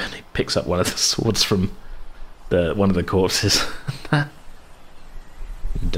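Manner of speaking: normal talking voice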